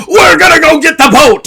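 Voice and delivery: rally the crew at full voice; pirate rallying shout. Acted. Captain rallying his pirates, shouting. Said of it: "other pirates and goes".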